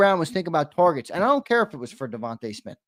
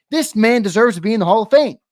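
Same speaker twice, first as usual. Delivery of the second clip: loud, raised-pitch rallying cry; impassioned endorsement; 6 semitones higher, 7 dB louder than usual. Acted. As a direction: proclaim it like a forceful advocate firing up voters. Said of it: "not an alpha type of guy that you would want to get up there and be like".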